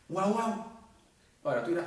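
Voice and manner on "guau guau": put-on higher, louder child's "guau, guau"; playful barking "guau"; dog imitation. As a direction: bark "guau, guau" like a toddler. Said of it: "si ve un perro".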